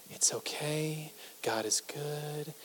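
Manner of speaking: slow, hushed whisper; unbothered calm person. Acted. Calm person soothing others in stressful situations, serene whisper. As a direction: whisper it slowly, utterly chill. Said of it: "really calm and chill all the time".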